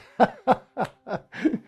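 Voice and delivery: loud burst of laughter; real laugh. Real, not acted. A man laughing about sleeping with huge cockroaches, loud real laughter.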